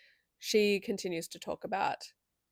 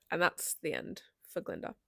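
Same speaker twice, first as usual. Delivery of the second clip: ordinary talk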